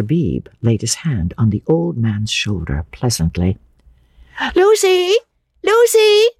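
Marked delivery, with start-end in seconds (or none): 4.6-6.3 high, loud sing-out from afar; loud, high-pitched summons; calling out. A narrator's reading. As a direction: call her from the carriage. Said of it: "called voices from the carriage".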